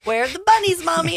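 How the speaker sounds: put-on high-pitched eager child voice; sweet put-on kid voice, lifted high; little boy. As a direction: imitate a young son asking his mom. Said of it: "he was like".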